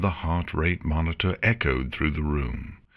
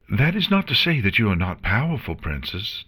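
fast, higher flustered blurt; blurted out quickly; 5 semitones higher, faster than usual. Acted. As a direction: rush it out before she's misunderstood. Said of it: "the nurse quickly blurted out".

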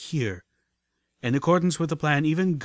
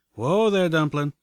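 higher, louder cowpony whoa; cowboy whoa; 5 semitones higher, 6 dB louder than usual. A narrator's reading. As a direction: rein her in like a cowboy. Calamity stopping Pip.

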